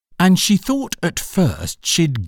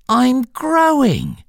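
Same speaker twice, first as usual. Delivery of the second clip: high, slow, meek admission; 13 semitones higher, 4 dB louder, slower than usual. Acted. Alice confessing to the Dormouse, high and meek. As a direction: admit it meekly, drawn out. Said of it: "said Alice very meekly".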